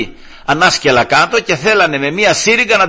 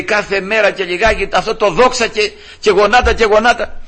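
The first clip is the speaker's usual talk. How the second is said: raised-pitch imitated grumble; exasperated complaint; 6 semitones higher than usual. Father protesting to his brother about kneeling, mock exasperation. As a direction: complain as if it is too much.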